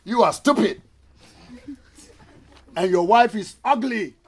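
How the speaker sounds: loud, mocking parrot insult with a laugh; cheeky parrot. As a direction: tease him like a rude parrot.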